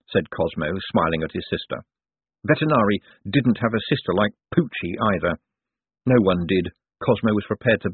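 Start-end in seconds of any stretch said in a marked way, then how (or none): none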